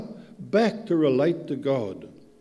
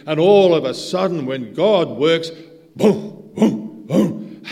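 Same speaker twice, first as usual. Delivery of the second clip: heartbeat booms; 4 semitones higher, 11 dB louder, slower than usual. Retold or imitated. Imitates heartbeat restarting.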